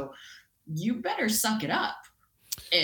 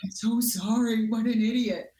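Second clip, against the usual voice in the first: louder, higher mock self-scolding; half-joking cry of regret; 4 semitones higher, 5 dB louder than usual. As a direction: scold yourself in jest. Self-mocking retold reaction.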